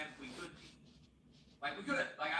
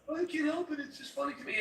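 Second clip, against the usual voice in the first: imitating Consultant; 10 semitones higher than usual. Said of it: "And he's like".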